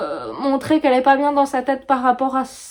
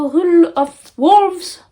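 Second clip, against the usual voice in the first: clumsy English accent; 5 semitones higher, 5 dB louder, much slower than usual. Real, not acted. French booktuber apologising for her English accent, clumsy English pronunciation. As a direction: Rule of Wolves said slow, high and loud in a shaky French-English accent. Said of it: "désolée pour mon accent tout pourri en anglais".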